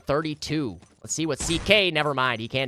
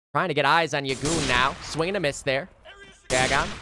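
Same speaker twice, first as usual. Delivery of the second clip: usual speaking voice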